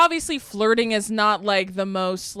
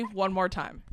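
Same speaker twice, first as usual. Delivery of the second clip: usual speaking voice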